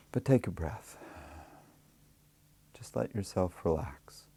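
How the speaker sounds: unhurried meditation voice with long pauses; guided meditation. Acted. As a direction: guide them gently into relaxation, leaving space after each phrase.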